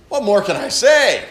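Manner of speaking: exasperated preacher reading. Shown as a demonstration. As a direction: throw up your hands. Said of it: "an exasperated tone".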